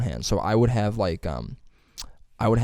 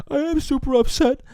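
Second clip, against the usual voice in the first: much higher, strained sobbing-guy voice; imitating bawling guy; 4 dB louder than usual. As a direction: play the crying buff dude, overwrought. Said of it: "He's like".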